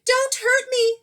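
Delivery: high, loud frightened plea; whimpering lion. Acted. Frightened lion begging the mouse not to hurt him, high and loud. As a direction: beg like a scared big cat. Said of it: "he whimpered".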